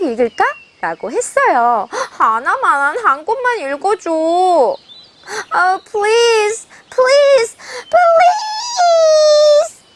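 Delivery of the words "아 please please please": each please climbing higher; begging hare. Acted. 토끼가 거북이에게 점점 높아지며 조르는 목소리. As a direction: plead harder and higher with every please.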